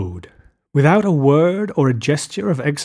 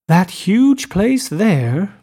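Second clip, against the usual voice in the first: high, slow exclamation of wonder; excited cry; 6 semitones higher, much slower than usual. Acted. Daisy points to Gatsby's mansion, crying out in excitement. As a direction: point it out in delight. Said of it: "she cried".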